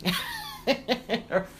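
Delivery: short laugh at her own slip; embarrassed laugh. Real, not acted. Host laughing at her own mispronunciation. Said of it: "I can't say that word".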